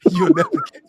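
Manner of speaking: high, rushed words swallowed by laughing; real laughter. Real, not acted. Storyteller quoting the taunt to guards, helpless laughter. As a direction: crack up halfway through the quote.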